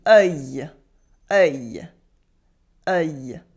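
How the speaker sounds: "Œil" low-pitched, rounded "uh" gliding to y; French œil sound. Shown as a demonstration. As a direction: model "Œil" for repeating, rounded vowel with y-glide. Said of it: "Repeat after me".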